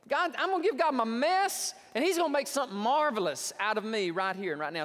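high, building fervour; eager convert. Acted. Lady in the congregation claiming the sermon, mock-eager.

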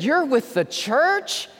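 a touch of mock surprise; surprised question. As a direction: let her be taken aback. Lady surprised in the story.

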